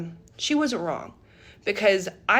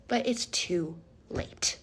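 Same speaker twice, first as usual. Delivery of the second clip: whispered; 5 semitones lower, 6 dB quieter, slower than usual. Real, not acted. Aside in disbelief.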